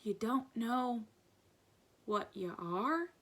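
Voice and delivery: slow, hushed question; bewildered. A narrator's reading. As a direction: draw it out in quiet amazement. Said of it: "with a bewildered stare".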